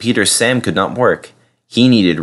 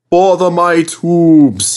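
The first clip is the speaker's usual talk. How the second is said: slow, louder, mildly out-of-breath engine; panting; 8 semitones higher, 5 dB louder, slower than usual. A narrator's reading. Skarloey climbing the hill short of steam, panting. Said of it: "he panted".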